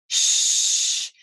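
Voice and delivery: sh hissed out unvoiced and long; sh sound. Shown as a demonstration. Teacher demonstrating sh to class, airy hiss. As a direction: push air through for sh. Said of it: "There's a difference between p versus".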